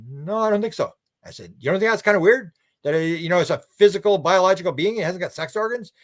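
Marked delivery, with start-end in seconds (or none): 0.1-0.9 unsure witness. Retold or imitated. Retold abductee answering. 1.6-5.9 pointed challenge. Retold or imitated. Speaker retells his own challenge.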